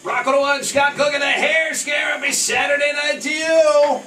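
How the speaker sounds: high, loud, pumped-up DJ announcing; radio DJ shout-out. Acted. DJ hyping the rock station to night listeners, loud and high. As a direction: hype the station ID like a rock jock.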